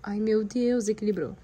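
ordinary delivery

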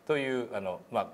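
usual speaking voice

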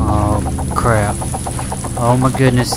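urgent, rattled muttering to yourself under threat; freaking out. Real, not acted. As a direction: keep yourself going while freaking out. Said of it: "I'm freaking out".

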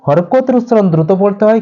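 usual speaking voice

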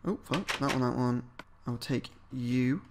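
ordinary delivery

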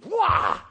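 loud high yell of attack; giant's attack yell. Acted. Giant striking David again with a loud yell. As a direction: bellow it as he swings.

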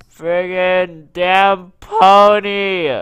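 real gamer rage; slow furious curse. Real, not acted. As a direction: spit each word slowly in anger. Cursing escaped Pokemon.

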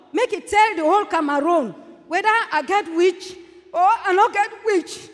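ordinary talk

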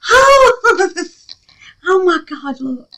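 high squeal breaking into a laugh; can't contain the delight; excited laugh. Real, not acted. Crafter admires the melted finish, laughing high. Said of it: "I'm so excited".

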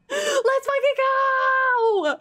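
slow, stretched, high victory shout; real ecstatic yell; triumphant scream. Real, not acted. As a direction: roar out the win with everything. Victory celebration.

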